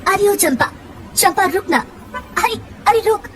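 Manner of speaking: calling out. A narrator's reading. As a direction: call her back as she runs off. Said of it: "पगली को आवाज देते हुए". (पीछे से पुकारकर रोकते हुए)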